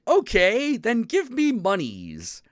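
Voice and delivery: cat shopkeeper voice. Retold or imitated. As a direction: play the cat spirit inviting a human to buy. Cat vendor asks for money.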